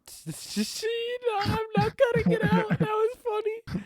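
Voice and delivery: high, loud laughing protest; laughing hard. Real, not acted. Co-host refusing to cut the awkward joke, laughing hard. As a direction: squeal it out through laughter.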